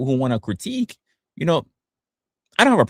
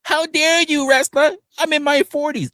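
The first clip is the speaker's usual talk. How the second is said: very high-pitched mocking outrage; mock indignant critic. Streamer imitating an older critic, high-pitched mock indignation. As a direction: squeal it like an affronted critic. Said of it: "and you sound like this?".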